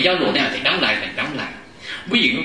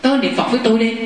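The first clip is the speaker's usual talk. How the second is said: excited retelling; 8 semitones higher than usual. Retold or imitated. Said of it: "Tôi tới tôi nói".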